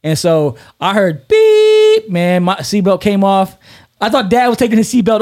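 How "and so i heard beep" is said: high, loud, drawn-out beep like a crash alarm; long alarm beep. Shown as a demonstration. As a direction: stretch the beep like a warning tone. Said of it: "one tone where it goes beep".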